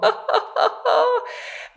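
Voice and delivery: high squawking seagull cackle; seagull laugh imitation. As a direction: cackle like a gull overhead. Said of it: "they laugh and they go".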